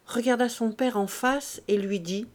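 ordinary delivery

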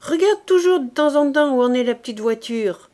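a high, loud call across the field. Acted. Cantinière to a passing corporal, loud high call. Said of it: "cria-t-elle".

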